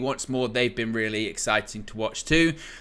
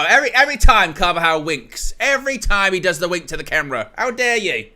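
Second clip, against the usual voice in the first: high, loud playful indignation; hammed-up exasperated scolding; mock outrage; 7 semitones higher, 6 dB louder than usual. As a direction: protest as if personally affronted by the wink. Mock outrage at player's wink.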